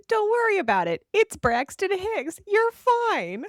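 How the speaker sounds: high, breezy mock reassurance; lightly hammed-up calm boss; mock reassuring Bobby. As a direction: brush it off in a lilting high voice. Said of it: "And Bobby's like".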